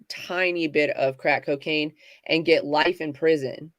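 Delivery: ordinary talk, nothing marked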